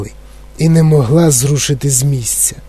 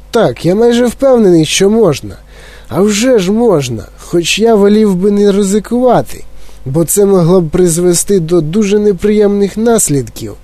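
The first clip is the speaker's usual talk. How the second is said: higher, hedging eccentric voice; reluctant Wonka; 5 semitones higher than usual. Acted. Wonka reluctantly admitting it can be done. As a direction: admit it, then back off cautiously.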